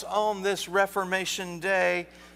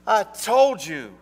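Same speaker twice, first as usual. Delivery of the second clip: slow, higher and louder, fed up; wife scolding husband for forgetting, exasperated; 4 semitones higher, 8 dB louder, slower than usual. Acted. Exasperated wife scolding.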